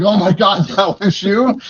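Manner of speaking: laughing, high-pitched oh-my-God; stunned realization. Retold or imitated. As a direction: suddenly realize who called you. Realizing the caller was Tyson.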